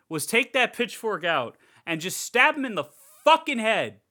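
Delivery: loud, high, building to a shout; exasperated rant. Real, not acted. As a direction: yell the obvious fix in total exasperation. Exasperated rant at character's mistake.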